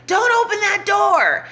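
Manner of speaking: high mock shout at the screen; a hammed-up cry at the movie screen; mock yell at screen. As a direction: yell it like a moviegoer warning the character.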